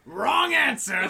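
loud, slow villain shout; hammed-up villain shout. Goblin yelling at Spider-Man as he throws the bombs, loud and slow. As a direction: bellow it like a cartoon villain.

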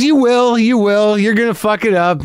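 high, confident, excited fan reassurance; cheerful earnest fan. Retold or imitated. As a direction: deliver bad news with upbeat fan excitement. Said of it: "he didn't change his tone".

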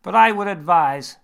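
a confident doctor holding forth; surgeon voice. Acted. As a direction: surgeon quizzing James, bright and loud.

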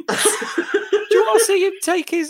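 loud, high rant breaking into laughter; real laughter bubbling through the words; laughing incredulously. Real, not acted. Incredulous joke told through laughter.

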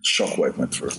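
ordinary talk, nothing marked

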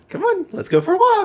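very high squeaky voice through laughter; laughing mock Haymitch. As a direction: squeak it out while cracking up.

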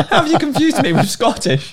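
high, laughing protest; amused disbelief through laughter. Real, not acted. Laughs at being called Scottish.

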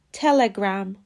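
تلگرام with a vowel after the l; careful 'tele-gram' for تلگرام; correct Telegram pronunciation. Shown as a demonstration. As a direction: repeat تلگرام as English 'TEL-e-gram'. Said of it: "باید بگیم تلگرام".

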